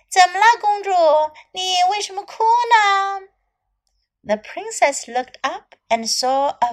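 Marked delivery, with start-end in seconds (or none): 0.1-3.3 high, loud little frog; a cartoon frog asking kindly. Frog line in translation.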